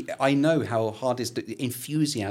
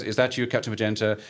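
usual speaking voice

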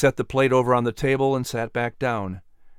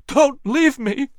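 high, slow, desperate cry; panicked plea; 12 semitones higher, 4 dB louder, slower than usual. A narrator's reading. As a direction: plead in panic, stretching it out. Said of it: "cried in a panicked voice".

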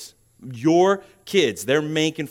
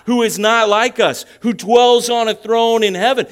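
louder, higher, driving preacher delivery; emphatic preaching; 6 semitones higher, 7 dB louder than usual. Real, not acted. Preacher urging congregation to speak of God with gravitas, emphatic preaching. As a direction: preach it with rising force and weight.

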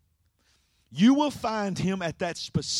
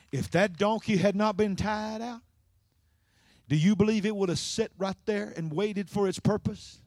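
normal talking voice

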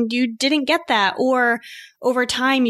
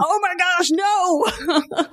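high-pitched, hammed-up dismay; mock horror; 8 semitones higher, much slower than usual. Host reacting to a phrase that grates like sandpaper, mock-horrified. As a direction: recoil in exaggerated dread. Acting out dread at a hated phrase.

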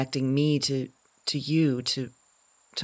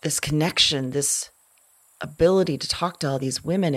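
normal talking voice

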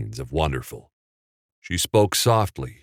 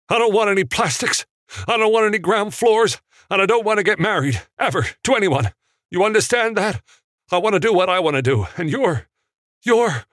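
loud, much higher, fast furious rant; explosive outburst; 4 dB louder, faster than usual. A narrator's reading. George erupts at Mary in the climax, loud and fast. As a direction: let it burst out of him faster and faster. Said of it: "gearing up for the explosive climax".

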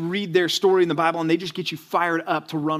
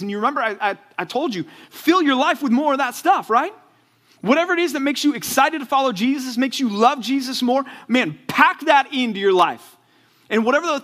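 louder, higher, urgent preacher voice; fiery preaching; 7 semitones higher, 5 dB louder than usual. Acted. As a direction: drive the point home to the students. Preaching at full force.

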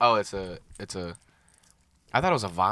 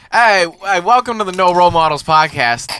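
loud upbeat host intro; podcast intro; 4 semitones higher, 7 dB louder than usual. Acted. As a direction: welcome listeners like a host. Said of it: "let me get focused".

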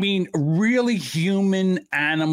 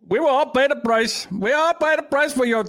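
higher, pompous mock announcer voice; mock newsreader; 8 semitones higher than usual. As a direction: ham up the accusing anchor. Mocking the clip's narrator.

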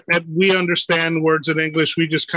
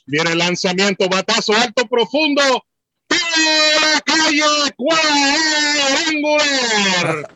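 home run call; 10 semitones higher than usual. Shown as a demonstration. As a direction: build the call higher and higher, stretch the last words. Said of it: "What's your home run call?".

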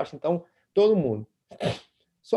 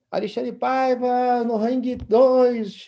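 chamada de alto-falante; 7 semitones higher, 4 dB louder, much slower than usual. Retold or imitated. Announcer calling next fight.

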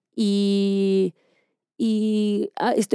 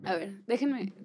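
composing oneself; 10 dB quieter than usual. Real, not acted. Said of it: "me considero una pacheca funcional".

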